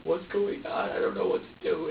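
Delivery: normal talking voice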